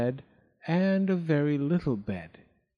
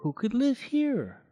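higher-pitched, curious question; wondering girl; 10 semitones higher than usual. A narrator's reading. Goldilocks at a strange house, wondering. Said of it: "Goldilocks wondered".

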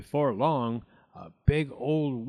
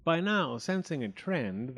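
ordinary delivery, nothing marked

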